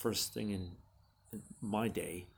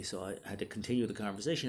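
ordinary talk, nothing marked

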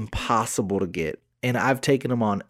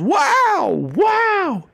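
high, excited infomercial wow; infomercial host; 4 dB louder than usual. Retold or imitated. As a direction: sell it like a TV pitchman. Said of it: "ShamWow commercials".

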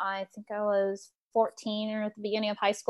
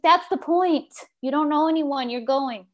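high-pitched mock parental insistence; imitated mom, starting high and emphatic; insistent mom; 5 semitones higher, 3 dB louder than usual. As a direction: voice a mom putting her foot down. Retold mother insisting.

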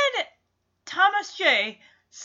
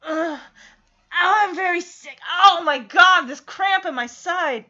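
groaning, louder mock agony; hammed-up sick person; 3 semitones higher, 6 dB louder than usual. Showing how Veda should fake illness, hammed-up groaning. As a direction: overplay a cramp for sympathy. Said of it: "play the part of a sick person".

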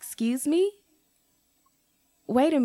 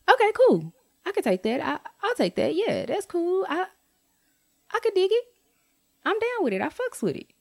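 high, light mock-agreeable voice; breezy pretend acceptance, a hint of laughter; 10 semitones higher, faster than usual. Vlogger imagining her reply to the credit, mock agreeable. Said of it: "if she would have said something like".

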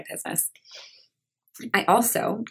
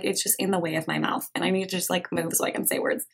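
ordinary delivery

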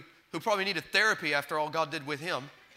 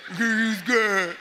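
louder, slow, laughing poke at him; laughing tease; 4 dB louder, slower than usual. Retold or imitated. God ribbing the frightened Gideon, laughing and louder. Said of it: "and he says".

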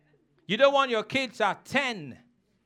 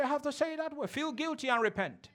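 blunt aside; 3 semitones higher, 10 dB quieter, faster than usual. Real, not acted. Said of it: "If I have to say it that way".

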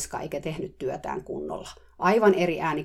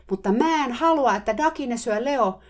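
much higher, loud mock yell; shouting; 8 semitones higher, 6 dB louder than usual. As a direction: yell the objection across the yard. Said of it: "alkoi huuto taas kuulua". (kova, korkea huuto)

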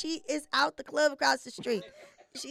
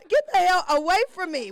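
mock rebuff; 6 semitones higher, 6 dB louder than usual. Shoving off imagined man.

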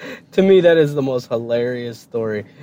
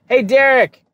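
polite call; 10 semitones higher, 4 dB louder than usual. Retold or imitated. Imagined polite wake-up call.

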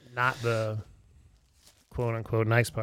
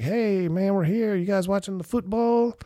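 cheery greeting; 8 semitones higher, 3 dB louder than usual. Retold or imitated. Greeting bar regulars.

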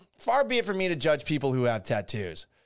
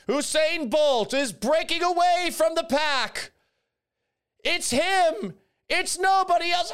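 high, hammed-up race announcer call, slowed for drama; mock sports commentator; 12 semitones higher, 4 dB louder than usual. Host playing commentator on an imagined Olympic relay, hammed-up announcer.

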